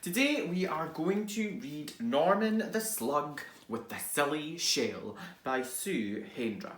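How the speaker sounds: ordinary delivery, nothing marked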